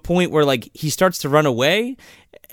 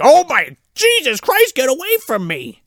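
high, loud panicked yelp; terrified; 12 semitones higher, 4 dB louder than usual. Retold or imitated. Host voices terrified kid fleeing the bully, high panic. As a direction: shriek like you're fleeing a monster.

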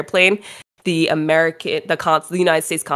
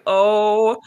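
ordinary talk, nothing marked

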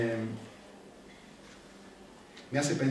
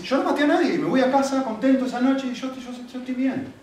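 high, emphatic show of innocence; mock self-satisfaction; 10 semitones higher, 4 dB louder than usual. Preacher playing believer who killed nobody, mock self-satisfied. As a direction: play someone loudly pleased with themselves.

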